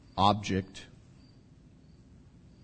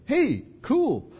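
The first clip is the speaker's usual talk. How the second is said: much higher, loud gleeful show-off; gleeful Peter; 12 semitones higher, 5 dB louder than usual. Retold or imitated. Peter walking on water calling to the disciples, gleeful. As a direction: Peter thrilled, showing off to friends. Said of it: "he's like".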